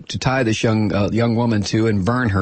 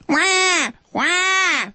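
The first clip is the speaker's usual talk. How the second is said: high mock baby wail, then breathing; newborn cry. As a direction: imitate a newborn's first cries. Imitating newborn crying.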